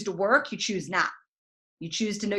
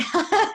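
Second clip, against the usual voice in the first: high, short burst of laughter; amused laugh; 12 semitones higher than usual. Real, not acted. Coach admits Googling quotes, amused high laugh. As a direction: laugh at your own confession.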